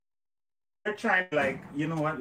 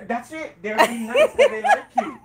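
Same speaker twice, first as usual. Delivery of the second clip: high laughing tease; 6 semitones higher, 8 dB louder than usual. Real, not acted. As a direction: laugh while you say it. Laughing teasing.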